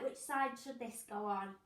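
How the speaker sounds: normal talking voice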